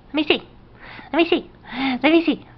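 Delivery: high, quick little coo. Real, not acted. Parent asking baby to let them see, coaxing baby talk.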